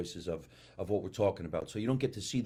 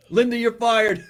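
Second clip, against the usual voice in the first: much higher and louder, hammed-up husband; mock angry husband; 14 semitones higher, 13 dB louder than usual. Playing Linda's husband firing her, mock angry. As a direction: bark it like a jokey outraged husband. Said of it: "That's her husband, Bob".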